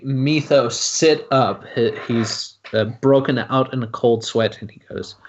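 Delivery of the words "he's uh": ordinary talk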